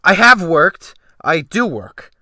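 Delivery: loud, high, hurt cry of defence; impetuous cry. A narrator's reading. Gentleman defending himself to the captain, impetuous loud cry. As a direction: fling it out to defend yourself. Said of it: "I cried impetuously".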